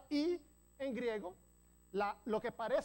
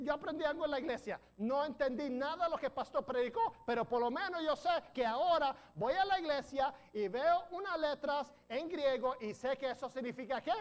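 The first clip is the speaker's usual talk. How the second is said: excited retelling; 3 semitones higher, 3 dB louder, faster than usual. Retold or imitated. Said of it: "y dicen".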